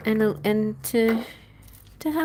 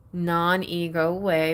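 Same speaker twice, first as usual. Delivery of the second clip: usual speaking voice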